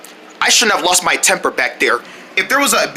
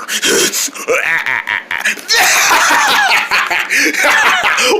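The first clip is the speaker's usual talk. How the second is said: cackling laugh; 10 semitones higher than usual. Acted. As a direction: let out a wild cackle. Evil laugh after threat.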